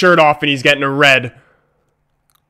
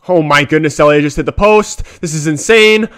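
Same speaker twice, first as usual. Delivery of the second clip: ordinary delivery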